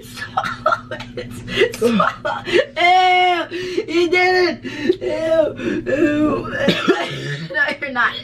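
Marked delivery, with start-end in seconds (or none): 0.0-2.4 laughing. Real, not acted. Urging friend to gulp. 2.5-6.5 excited. Real, not acted. Friend gulps vinegar drink.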